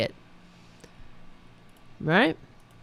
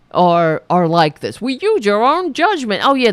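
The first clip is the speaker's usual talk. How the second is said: normal talking voice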